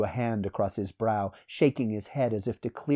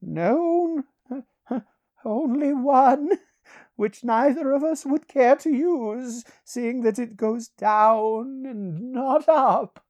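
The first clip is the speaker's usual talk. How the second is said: high, slow voice broken by chuckles; laughing; 6 dB louder, slower than usual. A narrator's reading. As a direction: chuckle darkly through a high, drawn-out reply.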